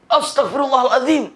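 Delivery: high-pitched mock dismay; alarmed exclamation. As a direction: blurt it out as if caught late. Tokoh terkejut iqamah sudah lewat. (seruan kaget, nada tinggi)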